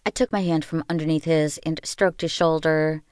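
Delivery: usual speaking voice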